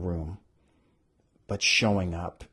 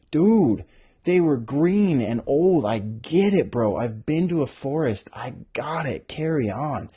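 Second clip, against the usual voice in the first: higher, louder bored bro voice; impatient dude; 6 semitones higher, 6 dB louder than usual. Retold or imitated. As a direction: brush it off like a bro. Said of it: "I was like".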